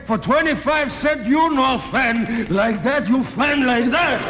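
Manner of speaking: slow, sing-song put-on Chinese accent; mock Chinese accent. Shopkeeper showing the customer how to fan, in a mock Chinese accent. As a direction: deliver the punchline slowly in a mock Chinese accent. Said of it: "The little Chinese man said".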